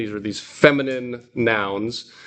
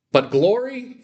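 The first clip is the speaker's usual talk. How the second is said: "glory" punched much higher, fronted; glory said high and strong up front; stress on glory; 10 semitones higher than usual. Shown as a demonstration. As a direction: lift "glory" high to show the Greek emphasis. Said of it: "putting the emphasis on glory".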